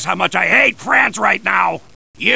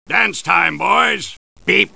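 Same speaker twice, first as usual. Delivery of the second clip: normal talking voice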